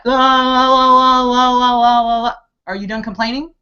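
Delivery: high, loud, exasperated question; mock exasperated demand to stop complaining. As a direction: shout the question up high, then drop it. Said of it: "It's like".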